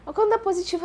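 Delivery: normal talking voice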